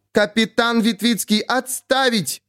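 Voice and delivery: high, shrill-edged command, almost a squeal; near-shriek order. A narrator's reading. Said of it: "чуть ли не взвизгнула". (чуть ли не взвизгнула)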